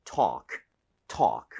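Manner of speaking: low "talk", open aw, silent L; model pronunciation. Shown as a demonstration. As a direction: model "talk" slowly apart, low, one open syllable each. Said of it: "두 번 들려드릴게요".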